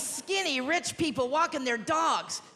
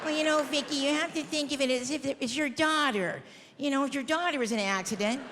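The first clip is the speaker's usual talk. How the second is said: lightly voiced preachy shelter worker; shelter staff. Shelter worker comparing a dog to your daughter, patronizing. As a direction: imitate staff gently lecturing an adopter.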